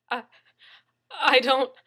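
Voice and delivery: frightened stammer. Acted. Captive stammering under threat.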